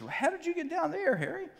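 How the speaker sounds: high surprised "how did you get down there"; George voice. Retold or imitated. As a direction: friend peering into the hole, amazed. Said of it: "And George says".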